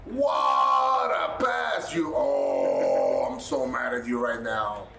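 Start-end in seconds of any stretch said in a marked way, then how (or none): 0.1-2.1 slow, high, loud mock praise; sarcastic shout. Real, not acted. Fan mocking a teammate's awful pass, sarcastic shout. 2.1-3.3 low, loud exasperated groan. Real, not acted. Fan groaning at a teammate's bad pass.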